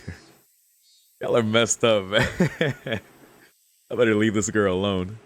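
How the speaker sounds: ordinary talk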